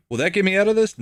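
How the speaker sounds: high-pitched eager question; hopeful detainee. Retold or imitated. Pulled-over player asking the cop for a way out, high hopeful voice. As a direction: pitch it well up, as a hopeful plea. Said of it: "And he's like".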